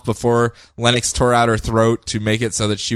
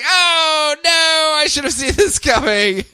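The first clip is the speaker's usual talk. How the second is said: high laughing mock despair; laughing dismay; slower than usual. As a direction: whine it through laughter. Villain's throat ripped out.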